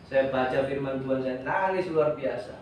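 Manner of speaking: ordinary delivery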